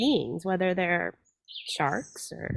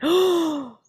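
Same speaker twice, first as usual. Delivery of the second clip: moved gasp; 8 semitones higher, 5 dB louder than usual. Retold or imitated. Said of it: "And I went, gasps".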